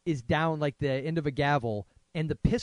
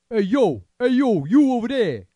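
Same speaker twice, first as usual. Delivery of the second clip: loud, higher Jersey 'hey yo' call; mock New York tough guy; 8 semitones higher, 10 dB louder than usual. Mocking the actor's emotional accent, loud New York tough guy. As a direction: shout it like a street tough. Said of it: "that kind of thing".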